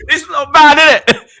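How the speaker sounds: put-on high, loud West African accent; It's not bad in a high West African voice. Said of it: "that's West African accent too".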